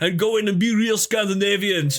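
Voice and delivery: lightly put-on Nordic accent, laughing at the end; a hint of a Scandinavian accent, building to a laughing shout; mock Scandinavian accent. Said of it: "that sounded like a fucking South African accent".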